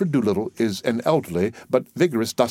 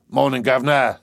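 expressive dustman's greeting; a touch of Cockney dustman; lightly voiced dustman; 5 semitones higher, 5 dB louder than usual. Doolittle the dustman's voice.

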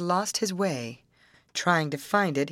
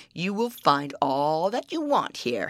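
troll voice; 2 semitones lower than usual. Acted. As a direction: growl it out like an old troll.

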